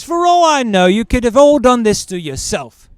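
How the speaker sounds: loud, high, scornful doctor; doctor loudly waving off the injuries; dismissive doctor. Retold or imitated. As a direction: brush her off loudly. Doctor dismissing, acted quote.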